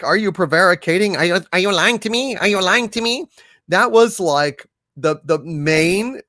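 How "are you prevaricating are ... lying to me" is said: fast accusing questions; mocking interrogator. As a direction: grill them like a suspicious debater. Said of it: "That was a key thing. Like".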